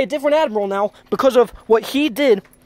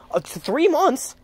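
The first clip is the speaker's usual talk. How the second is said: ordinary talk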